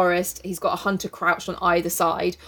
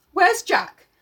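loud; 11 semitones higher, 4 dB louder than usual. A narrator's reading. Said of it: "Ralph spoke again loudly".

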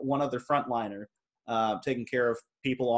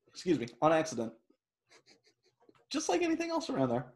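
hiccuping; 4 dB quieter, faster than usual. Real, not acted. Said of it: "Sorry, I have the hiccups".

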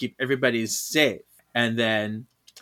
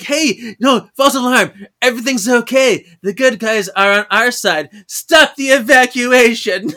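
louder, higher hammed-up call; hammed-up all-clear call; mock announcement; 7 semitones higher, 7 dB louder than usual. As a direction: call out the false alarm in a rush. Mocking Paige calling all clear.

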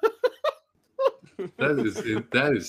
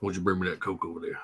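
low, soft, fast rock-star mumble; 6 semitones lower, 6 dB quieter, much faster than usual. Acted. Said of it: "I landed on [name]".

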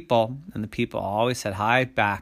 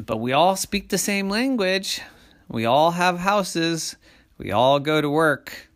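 higher, emphatic persuading voice; reasoning person; 6 semitones higher, 5 dB louder than usual. A narrator's reading. Person listing what everyone shares to monsters, reasoning. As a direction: make each point firmly at raised pitch.

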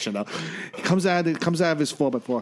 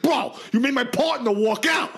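higher, exasperated mock shout; frustrated; 7 semitones higher than usual. Ambulance guy scolding the patient, frustrated. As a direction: imitate a fed-up medic raising his voice. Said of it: "gets frustrated".

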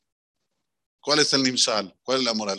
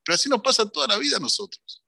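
ordinary talk

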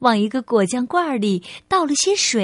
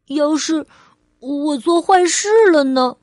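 put-on higher, childlike questioning voice; little child asking Mum for love; little girl Ali, unsure; 3 semitones higher, 4 dB louder than usual. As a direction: play a small child seeking reassurance. Child character asks mother.

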